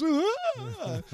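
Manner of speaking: high hammed-up cry; mock wail. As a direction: squeal like a fake sob. Mocking a tearful wail.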